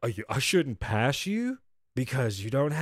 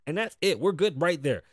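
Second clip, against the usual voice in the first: ordinary delivery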